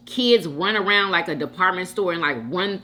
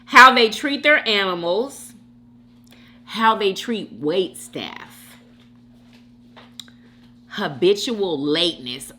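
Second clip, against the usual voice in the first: normal talking voice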